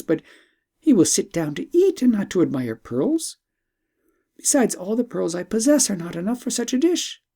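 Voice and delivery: a hint of an eager young princess. Princess objecting to bird.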